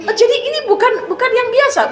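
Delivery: high, startled "Oh, jadi ini bukan"; the blind man suddenly realising; old man's surprise. Acted.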